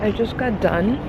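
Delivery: talking between bites; eating. Real, not acted. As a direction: mouth full, quiet. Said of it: "sorry, I'm eating".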